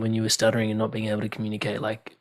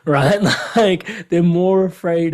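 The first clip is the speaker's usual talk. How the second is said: laughing; 5 semitones higher, 5 dB louder, slower than usual. Real, not acted. Host laughs at Seinfeld joke.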